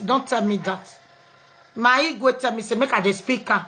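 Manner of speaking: usual speaking voice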